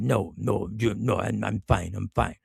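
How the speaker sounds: low, soft retold brush-off; dismissive customer. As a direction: imitate a man quietly declining help. Voicing the customer declining help.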